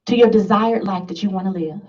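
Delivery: ordinary delivery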